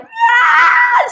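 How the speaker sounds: very high, loud scream; sudden high-pitched panic cry; system in alarm. Acted. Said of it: "Our system is".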